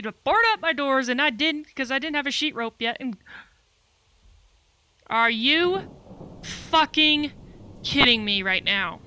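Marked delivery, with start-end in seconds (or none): 5.1-9.0 loud, outraged drawn-out yell; real anger at the broken door; furious disbelief. Real, not acted. Zombies broke his door.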